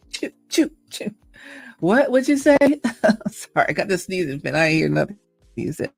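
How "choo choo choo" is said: high little fake sneezes; light pretend sneeze sounds; mock sneezes. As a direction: play-act a sneezing fit. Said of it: "respond as sneezy".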